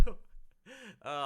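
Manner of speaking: laughing. Real, not acted. Bursts out laughing after joke.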